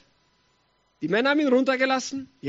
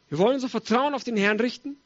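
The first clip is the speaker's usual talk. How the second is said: normal talking voice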